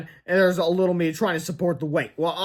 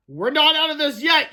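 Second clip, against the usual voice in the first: high, loud caution; acted urgent yell; warning yell; 10 semitones higher, 6 dB louder than usual. A narrator's reading. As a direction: shout a warning to a friend. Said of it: "yelled Fluffy".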